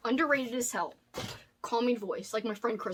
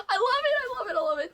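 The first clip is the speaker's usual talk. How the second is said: teary; 11 semitones higher, faster than usual. Real, not acted. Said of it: "I don't wanna get teary-eyed".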